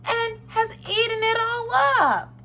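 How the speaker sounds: wee little high voice; Baby Bear. A narrator's reading. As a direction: pipe up like a tiny bear cub. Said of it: "in his wee little voice".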